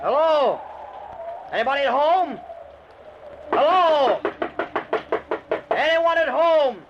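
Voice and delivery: loud, high calls into an empty house; calling out. Acted. Stranded driver calling into a dark house. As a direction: call out to whoever might be inside.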